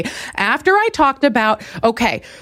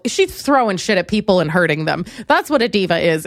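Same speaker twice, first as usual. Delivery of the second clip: normal talking voice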